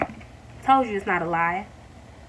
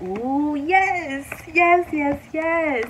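rising, higher and louder yeses; joyful yes yes yes; excited yes; 7 semitones higher, 4 dB louder, much slower than usual. Real, not acted. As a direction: cheer each card as it lands. Thrilled at cards turning up.

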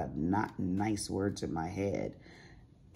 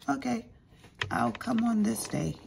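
high, louder mock contractor; mimicking contractor; 14 semitones higher, 4 dB louder than usual. Homeowner imitating the no-show contractor, high and louder. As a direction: toss off his empty promise. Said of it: "he's like".

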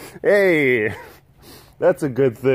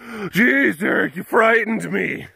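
mock viewer voice; 7 semitones higher than usual. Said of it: "collective sigh of relief".

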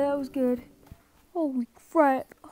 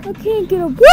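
high, loud panicked yell; panicked shout; 5 semitones higher, 6 dB louder, faster than usual. Real, not acted. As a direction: shout it like you're cornered. Trapped by monster.

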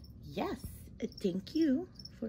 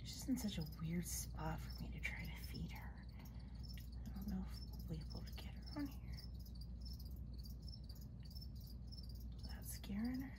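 soft careful whisper; whisper, don't startle her; 10 dB quieter than usual. Real, not acted. Trying not to scare spider.

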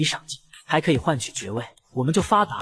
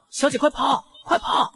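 high-pitched urgent cry to flee; desperate shout; 9 semitones higher than usual. A narrator's reading. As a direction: call out high, urging escape. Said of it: "大喊道".

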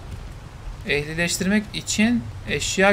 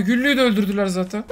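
usual speaking voice

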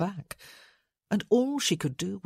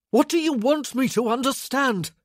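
loud, raised, exasperated outburst; bursting out; 7 semitones higher, 6 dB louder than usual. A narrator's reading. Man cracking under her accusations, bursting out. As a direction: burst out, pitch and volume up. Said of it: "he burst out".

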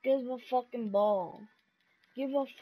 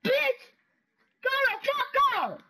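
much higher, loud furious shout; real rage yell; angry yell; 13 semitones higher, 5 dB louder than usual. Real, not acted. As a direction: yell him off the court. Yelling at game opponent.

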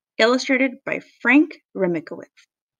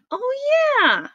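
very high, drawn-out realization; squeally storybook delight; excited cry. A narrator's reading. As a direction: remember with sudden glee. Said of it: "cried Froggy".

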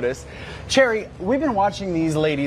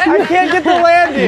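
high, loud, through laughter; real giddy laughing complaint; 12 semitones higher, 5 dB louder than usual. Real, not acted. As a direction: laugh helplessly at your own clumsy attempt. Failing the landing amid laughter.

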